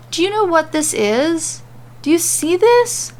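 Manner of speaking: high, eager questions; excited. A narrator's reading. Corbin pointing at the screen for Mandy, excited. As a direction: bubble with excitement. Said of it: "Corbin asks her excitedly".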